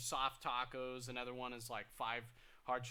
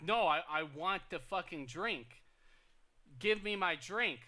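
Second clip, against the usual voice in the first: louder, rising into a retold shout; mock yelling customer; 4 semitones higher, 7 dB louder than usual. Customer yelling at the cashier for a free drink, mock shout. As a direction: play the furious customer demanding her drink. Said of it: "she just started yelling at me".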